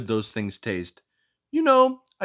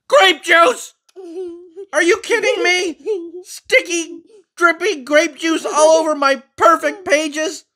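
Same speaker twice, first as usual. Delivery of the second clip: high, loud indignant scolding voice; fussy book character; 9 dB louder, slower than usual. Acted. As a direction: scold the reader for snacking. Character aghast at grape juice.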